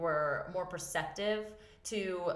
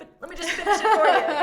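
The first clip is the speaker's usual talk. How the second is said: much louder, high, fast, laughing through it; laughing mock impatience; 13 semitones higher, 16 dB louder, faster than usual. Mom taking over son's shoe, fast and laughing. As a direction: grab the task away, laughing. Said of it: "I'm like".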